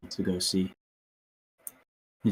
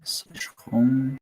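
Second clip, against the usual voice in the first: whisper; 5 semitones higher than usual. Real, not acted. Whispered voice command.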